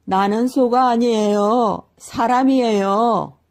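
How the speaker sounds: the cow-man shouting at the market crowd; desperate shout. A narrator's reading. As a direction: shout out slowly that you're human. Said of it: "다시 한번 외쳐보았습니다".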